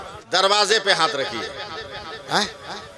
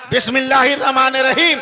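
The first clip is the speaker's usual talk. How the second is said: बिस्मिल्लाहिर रहमानिर Rahim proclaimed higher and louder; called-out recitation; 6 semitones higher, 4 dB louder, faster than usual. Shown as a demonstration. Preacher answering his own question to the crowd, proclaiming. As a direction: ring out Rahim. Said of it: "क्या पढ़ना है?".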